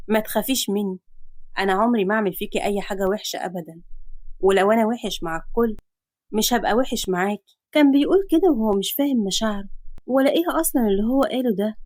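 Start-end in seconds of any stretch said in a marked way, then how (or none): 0.1-7.6 reassuring hero. Acted. Man soothing frightened girl, gentle.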